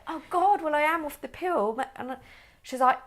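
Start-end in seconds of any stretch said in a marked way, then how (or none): 0.1-1.8 much higher and louder, retold alarm; mock flustered exclaim; flustered realisation. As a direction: relive the sudden panicked thought. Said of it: "I was like".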